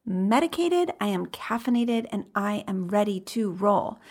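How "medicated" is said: excited cheer. Real, not acted. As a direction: cheer it like a party starter. Hyped podcast intro cheer.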